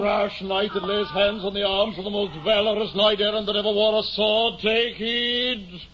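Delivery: high, lofty warning; knightly challenge. Acted. Don Quixote warning the mule carrier, lofty challenge. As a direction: warn the intruder off grandly.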